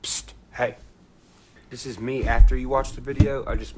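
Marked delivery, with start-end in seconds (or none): 0.0-0.8 hushed, breathy psst to the viewer; stage whisper. Acted. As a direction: whisper it like a secret aside. Viewer aside before the video.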